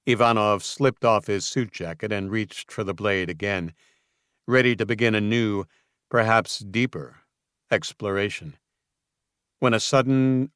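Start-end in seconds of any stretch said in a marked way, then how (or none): none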